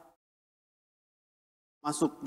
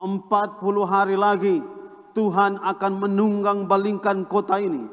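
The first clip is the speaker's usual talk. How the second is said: raised, higher-pitched proclamation of doom; prophet's shout; 6 semitones higher, 4 dB louder than usual. Retold or imitated. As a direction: cry out a warning to the whole city. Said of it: "mulai berteriak". (seruan lantang nabi)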